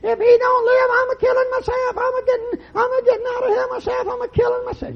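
hammed-up hysterical woman wailing; mimicking her hysterical screaming. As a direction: shriek it like a woman losing her mind. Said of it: "She was running up and down the hall screaming".

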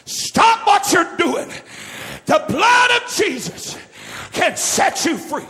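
high, loud, slow preaching shout; retold preaching. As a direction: call out to the listener on the tape. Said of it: "began to preach".

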